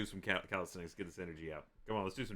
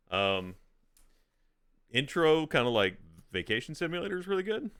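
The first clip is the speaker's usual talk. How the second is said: streamer talk; 14 dB louder than usual. Real, not acted. Streamer addresses viewers loudly.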